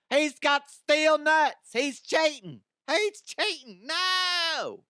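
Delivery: high, loud and slow hammed-up shock; mock outrage. Vlogger hams up shock at the cheating champion. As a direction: overplay the scandal.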